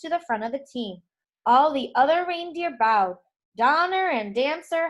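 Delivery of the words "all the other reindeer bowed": ordinary delivery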